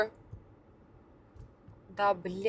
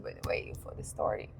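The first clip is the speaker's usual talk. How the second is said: sarcastic whisper; 12 semitones lower, 11 dB quieter, faster than usual. Real, not acted.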